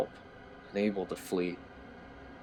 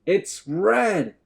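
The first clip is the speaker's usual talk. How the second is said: frightened; 11 semitones higher, 8 dB louder than usual. A narrator's reading. Scared prisoner repeats louder.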